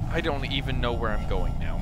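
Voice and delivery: ordinary delivery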